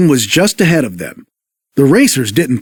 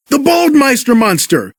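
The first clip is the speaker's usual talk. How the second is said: higher shout of terror; frightened shout; 8 semitones higher than usual. Acted. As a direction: cry out at the monster. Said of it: "Mater screamed".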